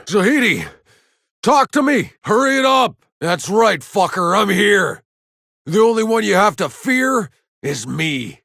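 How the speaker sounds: high, loud shouts over gunfire; battle yell. Acted. Soldier taunting enemies in a firefight, full-throated yelling. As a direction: bellow it across the battlefield.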